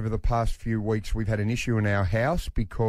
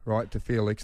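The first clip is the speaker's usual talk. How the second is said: ordinary talk, nothing marked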